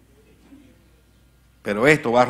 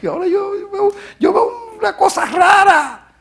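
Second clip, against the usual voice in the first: anguished cry; 8 dB louder than usual. Acted. Preacher crying out at the strange sight, anguished wail. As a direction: wail it out in dismay.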